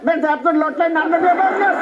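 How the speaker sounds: put-on quick retort in an Indian accent; mock Indian accent. Comedian answers an Indian doctor, mimicking his Indian accent. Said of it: "this Indian doctor came out".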